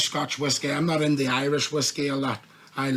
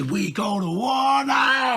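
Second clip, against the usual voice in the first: slow, high stage war cry shout; rally the crowd, drawn out; hype shout; 9 semitones higher, slower than usual. Retold or imitated. Hyping the crowd on stage, drawn-out war cry.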